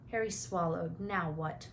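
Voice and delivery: ordinary delivery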